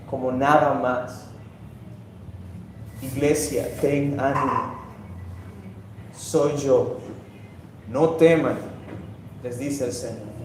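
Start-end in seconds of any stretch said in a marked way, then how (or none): 3.0-8.7 soft, low, consoling words; quiet, low voice of comfort; tender reassurance. Acted. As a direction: comfort them gently, with pauses between phrases. Same words, now consoling the church.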